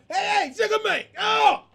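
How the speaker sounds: high, loud mock shout; excited bar-entrance yell, acted out; Jack Jenkins hollering. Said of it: "When Jack Jenkins comes into the bar".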